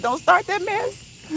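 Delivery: high-pitched, slow, drawn-out scolding; mock warning. Scolding girlfriends over bad grammar, high mock warning. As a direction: playfully warn them, rising at the end.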